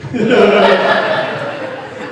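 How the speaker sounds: loud burst of laughter; big real laugh; hearty laugh. Real, not acted. Laughing at his own story.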